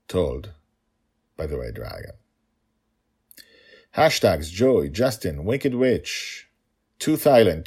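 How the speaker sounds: usual speaking voice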